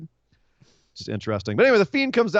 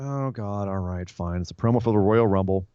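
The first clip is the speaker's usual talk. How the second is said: bored resignation; 6 semitones lower than usual. Retold or imitated. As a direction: grudgingly accept it, deflated. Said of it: "I was like".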